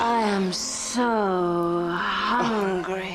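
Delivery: very slow, drawn-out craving; ravenous drawl. Acted. Starving woman cutting off her friend, ravenous drawl. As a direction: stretch the words out with hunger. Interrupts him with ravenous hunger.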